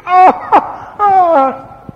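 high, loud crying wail; wordless wail of grief. Retold or imitated. Grieving wife at home, loud wordless wail. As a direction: wail grief that has no words. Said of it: "nu poate să spună durerea".

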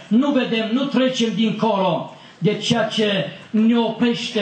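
high-pitched, insistent preaching; predică înflăcărată. Real, not acted. (predică apăsată, cu glas înalt)

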